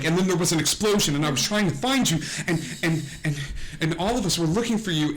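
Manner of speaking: high, rushed daughter babble; verbal rambling. Acted. Daughter babbles to her mother while hugging, rushed. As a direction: spill it out fast without stopping. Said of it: "goes into the verbal thing that she does".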